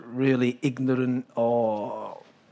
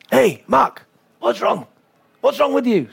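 raised, challenging; Kjell confronting; 7 semitones higher than usual. Retold or imitated. Kjell challenging Mark at the net, raised voice. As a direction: demand an answer, voice up. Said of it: "my body language is like".

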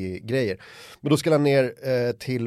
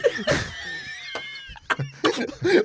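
breathy, unvoiced high laugh; burst of high laughter. Real, not acted. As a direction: explode with laughter. After the taunt.

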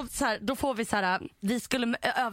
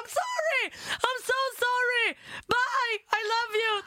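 shouted goodbye; 13 semitones higher than usual. Retold or imitated. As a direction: call back high while running away. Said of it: "jag bara".

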